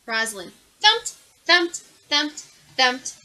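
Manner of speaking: each thumped punched loud; heavy thumps. A narrator's reading. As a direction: stomp the words down like feet on stairs. Thumping down the stairs.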